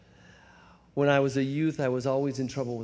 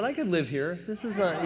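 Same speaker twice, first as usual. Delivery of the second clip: arrogant; 5 semitones higher than usual. Retold or imitated. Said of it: "that kind of arrogance".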